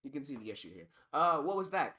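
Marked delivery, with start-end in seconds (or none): 1.1-2.0 high, loud startled question; gasped. A narrator's reading. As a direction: blurt it out in alarm. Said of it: "Phoebe gasped".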